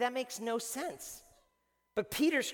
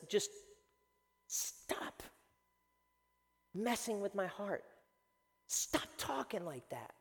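hushed, halting plea; hurt refusal; 8 dB quieter, slower than usual. Acted. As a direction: push the news away softly, word by word. Thomas refuses the disciples' news.

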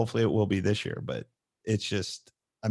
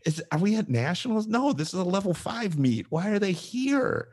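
higher-pitched mock disbelief; bewildered; 8 semitones higher than usual. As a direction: replay your own baffled inner voice. Acting out own bewilderment at meet.

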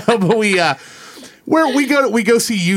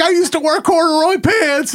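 much higher eager confession; mock nerdy confession; 12 semitones higher than usual. Guy confessing to the crowd, higher mock-eager. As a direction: squeak it out like a proud nerd. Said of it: "the guy's like".

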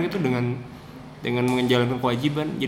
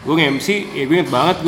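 ordinary talk, nothing marked

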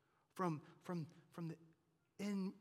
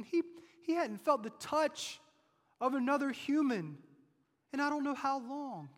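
loud, driving sermon delivery, higher pitch; full-force preaching; 9 semitones higher, 11 dB louder than usual. Acted. Preacher to congregation about the leper's isolation, full-force preaching.

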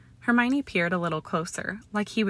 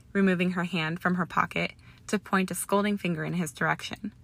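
ordinary delivery, nothing marked

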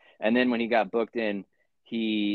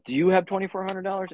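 cellmate; 11 semitones higher, 3 dB louder than usual. Retold or imitated. Cellmate voiced asking about bail.

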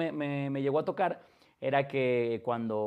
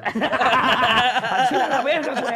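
grito alargado de sorpresa entre risas; sorpresa gritada; 8 semitones higher, 8 dB louder, much slower than usual. Real, not acted. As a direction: grita asombrado y lento. (¡a la verga! gritado y alargado)

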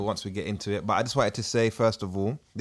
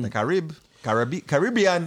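put-on Ugandan accent; 5 semitones higher, 4 dB louder than usual. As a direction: say Caribbean with a high Ugandan lilt. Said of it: "In the Ugandan accent, as requested".

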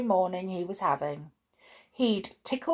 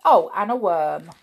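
ordinary delivery, nothing marked

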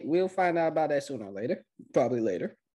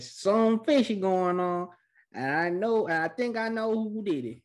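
mock suspicion; 7 semitones higher than usual. Recapper quoting a character who smells something fishy, hammed-up suspicion. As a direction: stretch it out high, like a hammed-up detective.

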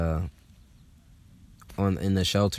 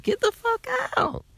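much higher squealed, delighted disbelief; gleeful disbelief. Retold or imitated. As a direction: shriek it in happy shock. Shocked both are Aquarius.

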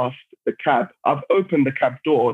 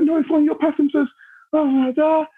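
high-pitched mock outrage; imitating crazed woman; 13 semitones higher than usual. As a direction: mimic her ranting way up high. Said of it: "going crazy".

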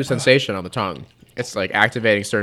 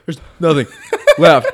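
loud, breaking up mid-phrase; higher, louder, words broken by laughter; helpless laughing; 4 semitones higher, 9 dB louder than usual. Real, not acted. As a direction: burst out laughing before the last word. Laughs over empty scotch, burning.

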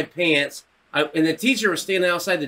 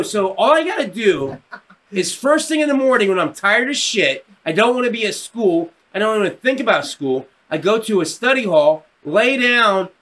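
higher, building incredulous list; delighted disbelief; 5 semitones higher than usual. Retold or imitated. Kid confirming a free credit deal, delighted disbelief. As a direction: tick off each easy step, amazed at the bargain.

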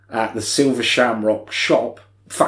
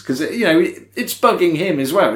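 ordinary talk

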